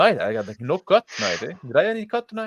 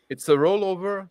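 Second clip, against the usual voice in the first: excited realization; 10 semitones higher than usual. Real, not acted. Amazed at the d100 rollover rule.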